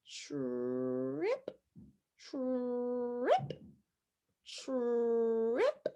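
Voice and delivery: soft, low "trip" with a long rolling "trrr" rising into "ip"; slow blended word. Shown as a demonstration. Teacher says it slow so friends listen, slow blended word. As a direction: stretch "trip" slowly and quietly in one glide. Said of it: "you say it slow".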